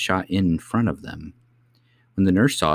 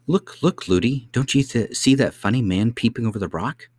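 put-on high, eager little girl's voice; high-pitched child calling attention; chattering princess; 4 semitones higher than usual. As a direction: point it out like an excited child. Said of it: "She was always saying".

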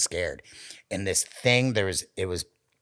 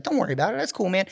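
high reassuring; 12 semitones higher, much faster than usual. Retold or imitated. Reassuring the friend, retold.

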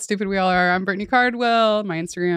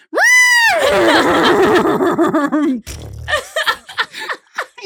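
high shrieking horse whinny cackle; whinny it out till the air runs out; evil cackle; 12 semitones higher, 4 dB louder than usual. Shown as a demonstration. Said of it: "Okay, ready?".

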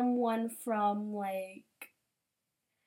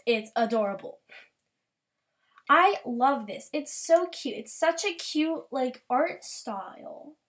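usual speaking voice

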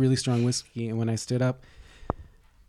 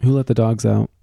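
teasing shout; 8 dB louder than usual. Acted. Teasing the cohost for being a dog, louder. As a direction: belt it out louder as a jokey jab. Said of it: "'cause you're being kind of a dog".

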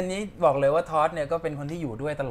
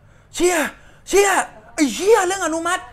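high, loud swearing outburst; ecstatic disbelief; 12 semitones higher, 10 dB louder than usual. Retold or imitated. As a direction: re-enact exploding at great news. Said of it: "กูแบบ".

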